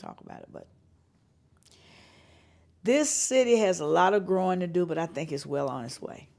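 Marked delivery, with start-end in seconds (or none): none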